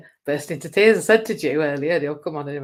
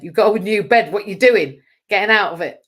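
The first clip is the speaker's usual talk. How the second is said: bossy inner voice; 4 semitones higher, 11 dB louder than usual. Retold or imitated. Voicing herself arguing with ego.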